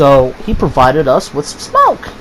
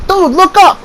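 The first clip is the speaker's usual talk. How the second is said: high, raised retelling of a call; 13 semitones higher than usual. Retold or imitated. Stoned friend says my name in the woods, high mock call. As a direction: imitate calling to someone up a tree.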